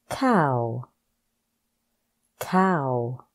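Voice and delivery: each word spoken slowly and clearly, with a falling end; a teacher's slow, clear word-by-word drill; careful pronunciation drill. Shown as a demonstration. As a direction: model every word distinctly for the learner to repeat. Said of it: "Practice these words with me to pronounce the words properly".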